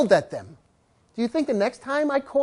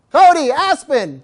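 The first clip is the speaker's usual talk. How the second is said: louder drawn-out call of two names; calling the dogs; 5 semitones higher, 6 dB louder, slower than usual. Retold or imitated. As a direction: call them slowly, loud.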